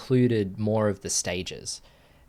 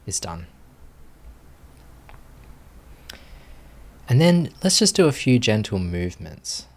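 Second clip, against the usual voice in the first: guided meditation. Acted. Teacher settling students into posture, calm guided meditation voice. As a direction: ease the group gently into stillness.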